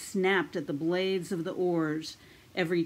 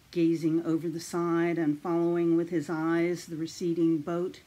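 usual speaking voice

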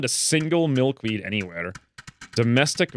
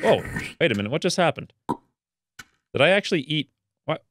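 ordinary talk

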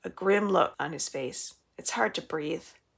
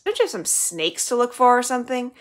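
sharp, higher and louder snap; snapping; 6 semitones higher, 6 dB louder than usual. A narrator's reading. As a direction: bite his head off. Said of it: "Violet snaps in his direction".

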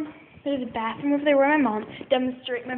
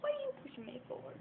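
high dog voice; 12 dB quieter, faster than usual. Pretend. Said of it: "She's like".

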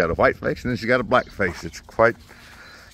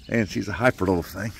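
ordinary talk, nothing marked

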